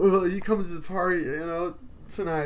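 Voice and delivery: put-on high, loud teen voice; teen party invite. Classmate inviting Sydney to the party, high excited teen voice.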